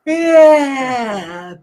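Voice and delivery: loud yawn-stretched yeah. Shown as a demonstration. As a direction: yawn through the word. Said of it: "let me see you, everybody now".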